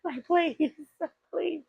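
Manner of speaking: high, slow, whimpering plea; hammed-up crying girl begging; high, fake-crying plea; mock crying Eve. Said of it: "I know Eve was out there crying".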